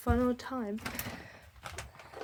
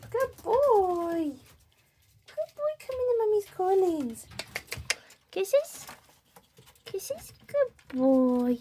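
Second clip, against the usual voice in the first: doting pet voice; 11 semitones higher, 6 dB louder, slower than usual. Real, not acted. Owner calling her ferrets to the salmon, high sing-song baby talk. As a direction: coo over the ferrets like babies.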